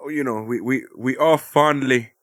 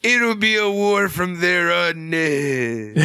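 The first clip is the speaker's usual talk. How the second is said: villain promo; 5 semitones higher, much slower than usual. Pretend. Continuing the villain promo bit.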